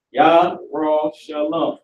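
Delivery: "Yah, Ra, Shalom" in separate beats, YAH, RAW, sha-lum; syllable breakdown. Shown as a demonstration. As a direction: teach "Yah, Ra, Shalom" one syllable at a time. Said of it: "is how you say Jerusalem in the Hebrew".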